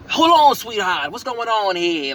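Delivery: put-on slow, drawn-out old-time gangster talk; forties gangster. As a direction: play a smooth 1940s mobster sizing someone up. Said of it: "Everybody talks like, you know, gangsters from the forties".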